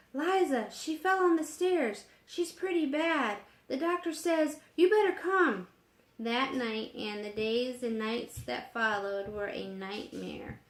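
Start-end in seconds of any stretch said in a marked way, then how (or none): 0.1-5.7 high, breathless, frantic; panting out bad news; out of breath from running, panicked; gasping. A narrator's reading. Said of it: "spoke in gasps".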